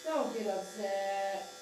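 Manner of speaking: slow, drawn-out coaxing chant; sing-song plea. Real, not acted. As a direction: soothe the dog with a lilting, repeated plea. Soothing the dog with chanted plea.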